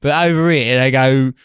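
mock Australian yob voice; Australian accent. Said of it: "That's kind of Australian".

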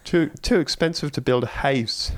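high, with "house" as "heis"; Irish accent imitation; mock Irish. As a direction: do an Irish couple fretting about cost. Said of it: "I'm not even doing it justice".

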